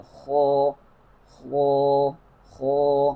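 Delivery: خو said far too full and heavy, rounded toward o; overly heavy kha. Shown as a demonstration. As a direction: overdo the heaviness on خو. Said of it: "بہت زیادہ پر پڑھ دیتے ہیں". (خو بہت زیادہ پر، غلط انداز)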